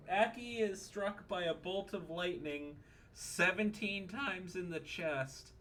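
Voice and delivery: ordinary talk, nothing marked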